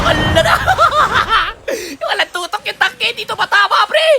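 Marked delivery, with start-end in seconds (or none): 0.6-2.0 amused gamer laugh. Real, not acted. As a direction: burst of real laughter. Laughing at game moment.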